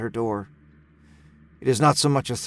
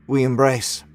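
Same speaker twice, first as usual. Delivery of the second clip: usual speaking voice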